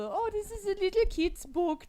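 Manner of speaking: put-on high, sing-song cutesy take on a kids' book; mock-sweet storybook gushing. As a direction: coo it like a sweet picture book. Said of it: "that slow transition from the".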